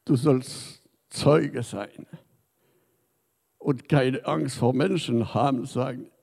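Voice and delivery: normal talking voice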